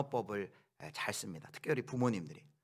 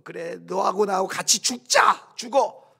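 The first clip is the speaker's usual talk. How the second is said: slow, much louder and higher desperate yell; exasperated mother yelling; 8 semitones higher, 12 dB louder, slower than usual. Retold or imitated. Mother grabbing her troublesome child, yelling in despair. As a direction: scream it like a parent at the end of her rope. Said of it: "자식을 붙들고 이렇게 얘기합니다".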